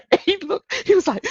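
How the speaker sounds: high, giggling through the words; can barely get it out for laughing; real laughter breaking through the story. Real, not acted. Laughing while retelling.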